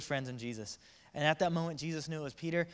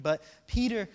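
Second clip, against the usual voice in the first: ordinary talk, nothing marked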